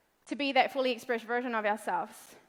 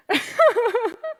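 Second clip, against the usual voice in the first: high laugh; 9 semitones higher, 5 dB louder than usual. Real, not acted. After telling dad story.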